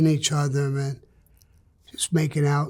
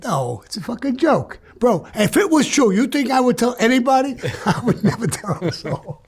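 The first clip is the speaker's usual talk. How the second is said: laughing through it, rising high; laughing denial; 7 semitones higher, 3 dB louder than usual. Real, not acted. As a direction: chuckle while brushing it off.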